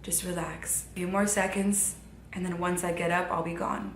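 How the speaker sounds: tender soft-spoken goodbye; sweetest softest voice. Retold or imitated. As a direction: coax her gently, barely above a breath. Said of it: "she goes".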